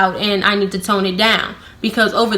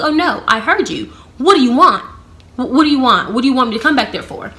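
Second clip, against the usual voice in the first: higher-pitched, insistent questioning; irritated demand; 4 semitones higher, 3 dB louder, faster than usual. Retold or imitated. Answering the coworker over the walkie, irritated and insistent. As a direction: fire back, fed up with being called.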